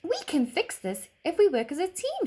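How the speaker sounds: put-on high, firm little girl; rally the team as a small girl; confident girl. A narrator's reading. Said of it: "she said confidently".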